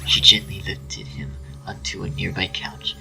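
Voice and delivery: ordinary delivery, nothing marked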